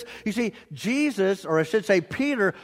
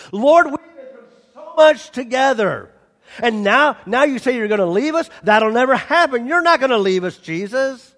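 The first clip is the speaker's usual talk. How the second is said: loud, emotional plea not to be left; loud pleading Peter; 5 semitones higher, 5 dB louder than usual. Acted. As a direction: beg Jesus loudly not to leave.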